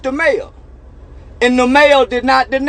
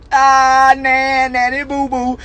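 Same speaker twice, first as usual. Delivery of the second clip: singsong taunt; 3 semitones higher, slower than usual. Real, not acted. Childish taunt at critics.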